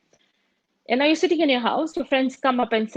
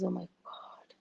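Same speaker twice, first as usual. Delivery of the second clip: exasperated whisper; 5 semitones lower, 17 dB quieter, slower than usual. Retold or imitated. Husband's exasperated aside.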